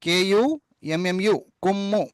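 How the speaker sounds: కేయు ఎంఎంయు spelled out slowly, letter by letter, then కుమ్ము; spelling aloud. Shown as a demonstration. Teacher spelling కుమ్ము in English letters for the class, slowly. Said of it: "ఒత్తు మాను ఎం మధ్యలో పెట్టేసేయండి".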